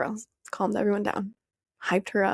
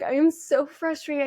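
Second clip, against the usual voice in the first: frustrated; 7 semitones higher than usual. Retold or imitated. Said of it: "And I was like".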